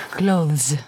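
Clothes with voiced th and final z; voiced th then z. Shown as a demonstration. She shows she can say Clothes, voiced th into z. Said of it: "Just watch me".

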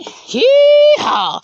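high, loud drawn-out whoop; big high-pitched holler; loud hee-haw. Pretend. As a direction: let out a playful hee-haw yell. Whoops after boasting.

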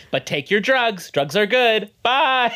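high, climbing sing-out to a farewell; hammed-up high sign-off; mock cheery. Voicing friends parting over drugs, mock cheery.

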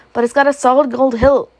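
insistent. A narrator's reading. The little trooper insisting to the sergeant about the gold hilt, loud and pushy. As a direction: press the point like a greedy soldier. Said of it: "insisted the little trooper".

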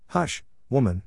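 understated snappish rebuke; snapped. A narrator's reading. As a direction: cut the listener off with a touch of contempt. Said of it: "Harry snapped at her".

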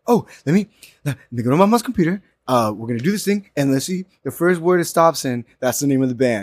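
high, quick, jittery voice; fidgety friend talking fast and high; fidgety drummer. Retold or imitated. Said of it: "he was very, like, fidgety when he talked".

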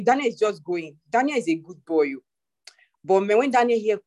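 ordinary talk, nothing marked

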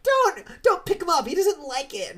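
very high, loud fussing over a pet; mock pet-owner talk. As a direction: fuss protectively as if over a little animal. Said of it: "We talked to you like you were, like, a pet".

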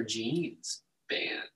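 whispering. Real, not acted. Joking aside to bandmates.

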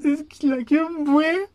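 put-on crying for his girlfriend while being fed pizza; drunk sobbing. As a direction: blubber it, voice climbing into a wail. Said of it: "Y estaba llorando y llorando".

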